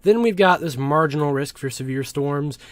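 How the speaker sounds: normal talking voice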